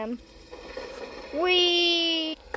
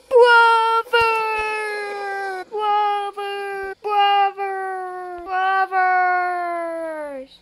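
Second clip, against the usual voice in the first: calling out; 3 semitones higher, much slower than usual. Acted. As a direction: shout for help across. Calling out for his brothers.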